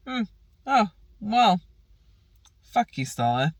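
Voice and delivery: sarcastic retort. Retold or imitated. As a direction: toss it off with fake nonchalance. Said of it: "I'm like".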